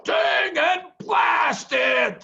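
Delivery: angry curse. A narrator's reading. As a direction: spit it out like a furious farmer. Boggis curses the miss.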